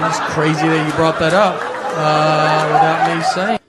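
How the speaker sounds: loud, gushing mock delight; hammed-up eager cop; mock excited cop. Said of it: "Then you're like".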